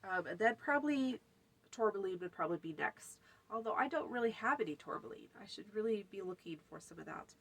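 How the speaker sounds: ordinary delivery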